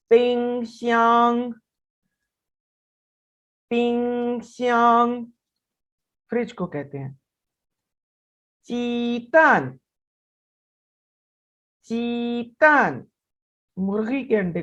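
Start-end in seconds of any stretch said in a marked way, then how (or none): 0.1-5.3 पिंगश्यांग said very slowly, higher, each tone drawn out; Chinese word demo. Shown as a demonstration. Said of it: "Read after me, please". 8.7-13.1 चीतान said very slowly and higher, ending in a fall; Chinese word demo. Shown as a demonstration. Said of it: "Read after me, please".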